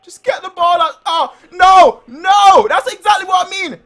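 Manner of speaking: frustrated shouting at the players; yelling from the sidelines. Real, not acted. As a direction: bellow it like a fan losing patience.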